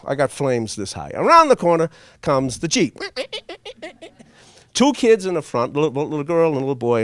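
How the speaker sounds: usual speaking voice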